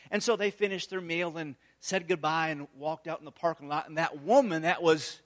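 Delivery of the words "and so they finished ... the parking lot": normal talking voice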